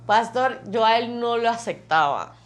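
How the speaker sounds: hablando entre risas; riendo. Real, not acted. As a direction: cuenta riendo, feliz por su esposo. (dice riendo que es su bendición)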